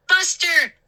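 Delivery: high, loud call; cry. Acted. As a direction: cry out the dog's name. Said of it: "Mindy cried".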